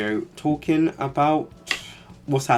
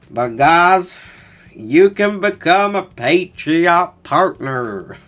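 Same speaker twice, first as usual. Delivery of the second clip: slow, higher, louder American ad-read voice; mock American accent; 7 semitones higher, 8 dB louder, much slower than usual. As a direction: sell it like a hammed-up American advert. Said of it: "My American American accent needs work though".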